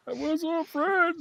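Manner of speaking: put-on very high old man greeting; the old brother driving up to greet Fred; chipper old man. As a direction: greet him like a sprightly geezer. Older brother imagined greeting.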